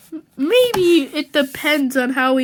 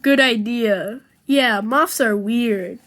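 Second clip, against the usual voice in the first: usual speaking voice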